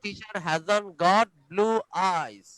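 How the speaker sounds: very slow, each word separate; slow model reading. Shown as a demonstration. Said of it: "teacher repeats slowly".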